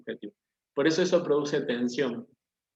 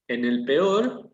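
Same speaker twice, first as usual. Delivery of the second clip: peor drawn out slowly with a rise; stress on peor; 4 dB louder, much slower than usual. Shown as a demonstration. Teacher slowly stressing peor for the class. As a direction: linger on peor, very slow. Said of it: "lo que hace es enfatizar el peor".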